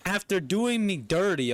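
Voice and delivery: high, mock-wounded scolding; indignant outburst. Scolding someone who came back, indignant outburst.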